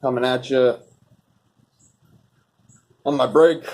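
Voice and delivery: ordinary talk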